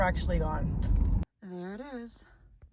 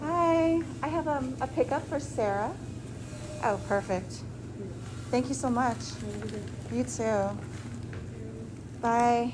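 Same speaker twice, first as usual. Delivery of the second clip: polite service voice; 4 semitones higher than usual. Real, not acted. Talking to restaurant staff.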